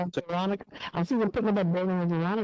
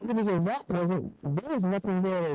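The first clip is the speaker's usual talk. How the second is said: ordinary talk, nothing marked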